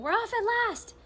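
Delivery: high, loud excited shout. A narrator's reading. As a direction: yell it out, the trip is starting. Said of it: "someone was shouting".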